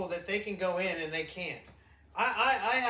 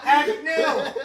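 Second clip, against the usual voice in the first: high, loud laughing alien; laughing put-on alien voice; mock alien voice; 9 semitones higher, 15 dB louder, slower than usual. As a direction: squeal it like a silly alien.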